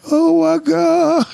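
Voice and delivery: high, slow, wailing mock cry; imitating wife crying. Imitating his wife crying outside the locked door, high drawn-out wail. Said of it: "she was just outside the door crying".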